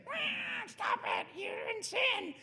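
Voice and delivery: very high squeaky guinea-pig shriek; tiny rodent yelling a rebuke; squealing guinea pig. Retold or imitated. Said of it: "and he's like".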